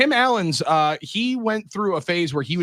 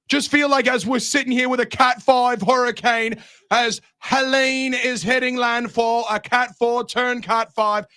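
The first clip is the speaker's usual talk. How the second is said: higher, drawn-out British weather report; British accent; 8 semitones higher, slower than usual. Shown as a demonstration. Host re-enacting his weather report in a British accent. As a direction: read the storm news with a British air. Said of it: "had to be more of a British accent".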